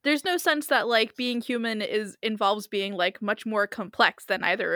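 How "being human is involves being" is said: normal talking voice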